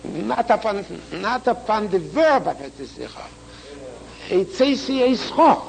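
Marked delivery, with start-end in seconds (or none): 4.3-5.6 final Cho punched, pitched high; stress on Cho. Shown as a demonstration. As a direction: set the last syllable Cho apart and hit it. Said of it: "the Chof, the last Chof".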